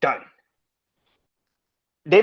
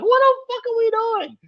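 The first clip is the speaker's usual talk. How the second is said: high, frantic cry of disbelief; exasperated outcry. Real, not acted. Host fed up with WWE booking, high exasperated cry. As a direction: throw your hands up with your voice.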